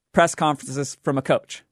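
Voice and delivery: ordinary talk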